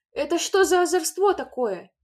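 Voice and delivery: a furious mother's cry; angry shout. A narrator's reading. Mother to the children, furious scolding. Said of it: "сердито закричала она".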